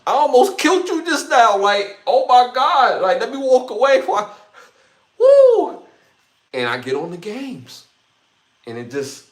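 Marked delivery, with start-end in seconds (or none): 0.1-4.3 retold laughing disbelief. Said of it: "I was like". 5.2-5.8 high, loud whoop; sudden shrill whoop of release; loud woo. Real, not acted. Exclaims after retold story.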